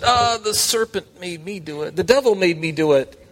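hammed-up blame-shifting whine; whiny excuse. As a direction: play the guilty woman passing the blame. Acting the woman's excuse.

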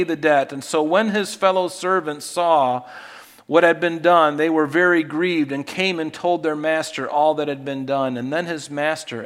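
loud, high, fervent scripture reading; booming sermon reading; preaching at full force. Acted. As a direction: proclaim it to the whole church. Preacher reads the parable aloud.

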